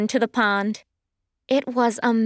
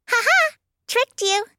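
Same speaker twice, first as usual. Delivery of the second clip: very high, teasing bird voice; gleeful little firebird; 12 semitones higher than usual. Acted. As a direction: crow over a successful prank. Small firebird teases friend.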